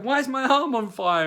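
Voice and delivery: high, loud alarmed cry. Retold or imitated. To Claire on waking, high loud alarmed cry. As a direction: panic about the burning arm.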